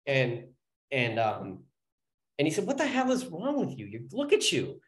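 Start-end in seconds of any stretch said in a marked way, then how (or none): 2.7-4.8 retold exclamation, pitched high at the start; mock-exasperated judge. Judge to soaked probationer, mock exasperation. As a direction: imitate a judge's baffled scolding.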